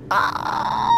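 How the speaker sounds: long, high-pitched scream of frustration; real drawn-out shriek; frustrated scream. Real, not acted. Player after wrecking his car, frustrated scream.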